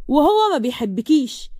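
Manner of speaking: ordinary delivery, nothing marked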